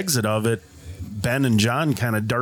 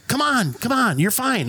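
higher-pitched mock coaxing; higher, insistent quote of a friend; imitating coaxing friend; 10 semitones higher than usual. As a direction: retell a buddy urging you on. Retelling friend coaxing him down.

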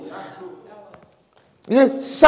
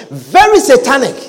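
ordinary delivery

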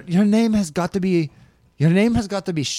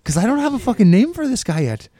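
higher, lilting Irish voice; high-pitched mock Irish persona; put-on Irish accent; 4 semitones higher than usual. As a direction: chat in a raised Irish voice. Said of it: "I've been talking like this".

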